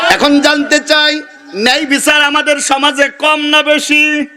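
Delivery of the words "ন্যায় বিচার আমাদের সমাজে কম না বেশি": usual speaking voice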